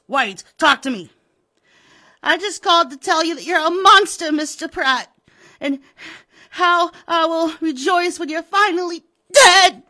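shrill, loud, fast angry accusation; furious call. Acted. Widow raging at Pratt over the phone, angry. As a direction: spit it down the phone at him.

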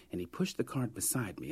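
ordinary talk, nothing marked

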